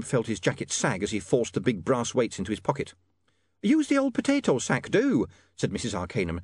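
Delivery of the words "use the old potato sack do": high, fussy landlady voice; a prim landlady in a flap; flustered landlady. A narrator's reading. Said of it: "now quite flustered by events".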